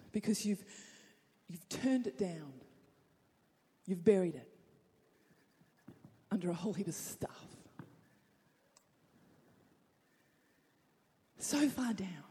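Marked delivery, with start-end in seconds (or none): 3.9-12.2 whispered; a preacher dropping to a whisper. Real, not acted. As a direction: breathe it out, barely sounded. Quiet drop in the sermon.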